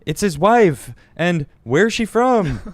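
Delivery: high, loud mock viewer chatter; hammed-up nosy chat voice; imitated excited crowd. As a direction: exaggerate the buzz, stretching out the question. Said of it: "And then everyone's like".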